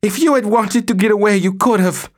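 wife scorns husband in shrill anger. Acted. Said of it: "her shrill, speeded-up voice".

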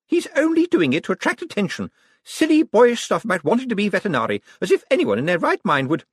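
high, scornful chatter; dismissive, prattling woman. Acted. Sister mocking her brother's obsession, dismissive.

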